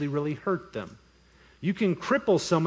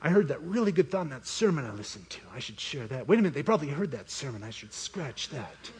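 hushed self-talk, sinking very soft; inner monologue; 3 semitones lower, 5 dB quieter than usual. Acted. Small group member weighing what to share, quiet inner monologue. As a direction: think aloud under your breath. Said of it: "What's your inner monologue when you're praying".

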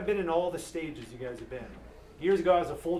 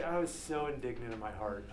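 ordinary delivery

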